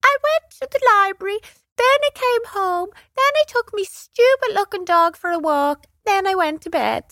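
very high, silly mocking squeak; stupid high-pitched voice. A narrator's reading. Brother mocks his sister's life story, silly high-pitched voice. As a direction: mimic your little sister in a daft high voice. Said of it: "put on a stupid high-pitched voice".

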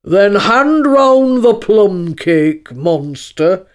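very slow, booming sleepy lion; ponderous, tired lion voice; weary lion. Acted. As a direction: give a lazy order in a loud, hollow drawl.

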